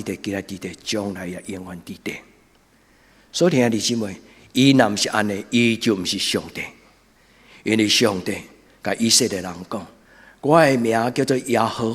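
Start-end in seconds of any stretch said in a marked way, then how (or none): none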